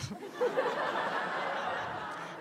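a quiet, high, unvoiced laugh; high breathy laugh. Real, not acted. At her own punchline, a quiet high breathy laugh. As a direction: laugh through breath at the joke.

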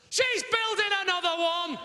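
high, slow comic shout; horrified realisation. Acted. Husband realising wife's plan, mock-horrified shout. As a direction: cry it out as a dawning horror.

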